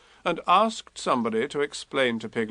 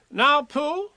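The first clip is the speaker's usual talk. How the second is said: higher, bossy little Rabbit voice; Rabbit taking command; 13 semitones higher than usual. A narrator's reading. Rabbit ordering Pooh and Eeyore at the bridge, high and bossy. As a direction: give orders like a fussy organiser.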